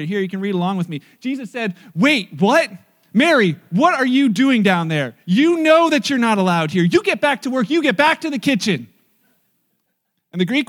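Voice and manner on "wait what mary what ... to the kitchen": loud, indignant telling-off; raised-voice bossy reprimand; mock scolding. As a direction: order her back with hammed-up outrage. Joke scolding voiced as Jesus.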